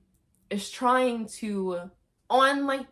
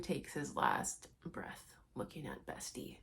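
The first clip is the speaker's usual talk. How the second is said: whispered; 7 semitones lower, 14 dB quieter than usual. Real, not acted. Dramatic death moment retold.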